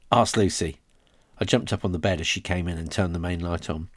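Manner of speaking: usual speaking voice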